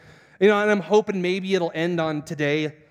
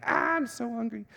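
high, soft strained whine; hammed-up groan of hunger; mock hunger whine; 7 semitones higher, 6 dB quieter than usual. Fasting worker smells catered food, whining.

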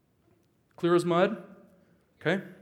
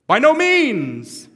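booming, much higher and slow; ironic "gentle" roar; 11 semitones higher, 15 dB louder, much slower than usual. Acted. Pastor reads Paul's reply with mock gentleness, booming slow shout. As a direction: thunder it out after promising gentle. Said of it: "he says very gently".

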